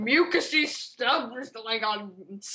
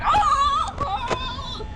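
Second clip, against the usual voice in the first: tearful high wail; hammed-up burst of sobbing; Chica; 11 semitones higher than usual. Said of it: "Chica breaks down in tears".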